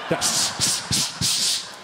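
repeated sharp "s" bursts; dog trainer's hiss. Acted. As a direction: hiss short commands at a dog.

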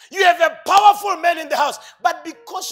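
full-force preaching. Acted. Preacher shouting to the congregation, full force. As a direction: drive it home to the church.

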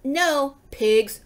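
drawn-out, scolding call; mild shout. A narrator's reading. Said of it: "Eugenia shouted".

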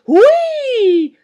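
piercing high, loud shriek; high-pitched yelp of shock; startled shriek. A narrator's reading. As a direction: shriek it out in sudden fright. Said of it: "schrie sie".

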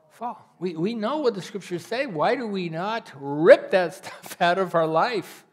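normal talking voice

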